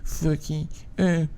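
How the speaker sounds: high, soft trashy dude voice; coworker impression. Imitating the guy who comes into work, high soft voice. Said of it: "This is how he talks to me".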